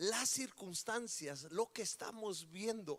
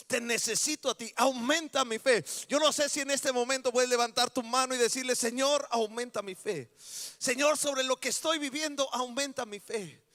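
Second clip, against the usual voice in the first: louder, higher prayer begging for faith; fervent shouted prayer; 5 semitones higher, 9 dB louder than usual. Shown as a demonstration. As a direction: pray it out at full voice. Loud modelled prayer.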